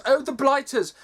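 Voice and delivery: hammed-up proper English accent on "Blighters"; mock posh English accent. Mocking the game's voice actors, hammed-up posh English. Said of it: "Not the fucking Blighters".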